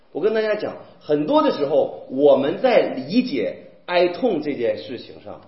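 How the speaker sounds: ordinary talk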